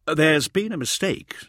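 anxious to explain; placating protest. A narrator's reading. Hauser trying to placate armed soldiers.

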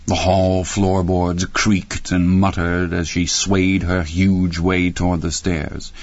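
usual speaking voice

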